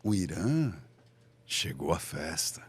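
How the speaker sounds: very low, slow, with a pause after "Irã"; disdain. Shown as a demonstration. Speaker demonstrates scorn at a guest's arrival, low slow disdain. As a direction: let "chegou à festa" sink slowly, dismissively. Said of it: "desdém".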